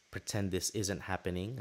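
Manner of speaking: ordinary delivery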